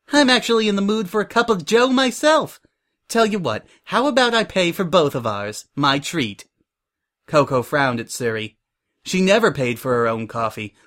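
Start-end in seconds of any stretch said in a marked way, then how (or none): 0.1-6.5 high-pitched, perky enthusiasm; eager exclaiming. A narrator's reading. Suri eagerly offers to treat Prim, exclaiming. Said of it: "Suri exclaimed".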